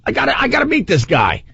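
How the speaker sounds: high-pitched, eager retelling; retold eager urgency. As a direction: pitch it way up, desperate to catch him. Urgently asking the fan, retold.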